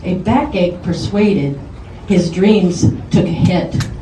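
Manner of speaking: a friend reciting his birthday poem, loud and rhythmic; poem recital. Acted. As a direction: declaim the birthday rhyme to the crowd.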